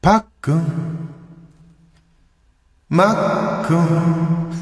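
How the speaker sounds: high, very drawn-out announcer call; stadium announcer. Acted. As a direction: call it slowly and high, as into Koshien's echo. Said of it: "甲子園のエコー".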